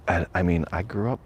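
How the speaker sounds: ordinary delivery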